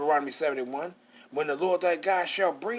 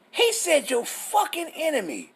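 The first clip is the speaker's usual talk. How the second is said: much higher, indignant punch on enemy; heated retort; 9 semitones higher than usual. Real, not acted. Preacher correcting a mock misreading, high and heated. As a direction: snap back the correction.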